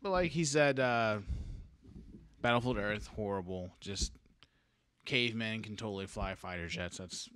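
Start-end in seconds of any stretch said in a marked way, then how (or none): none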